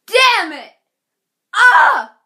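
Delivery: loud, high groaning curse; frustrated outburst. Retold or imitated. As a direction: blurt it out fed up. Frustrated by the long wait.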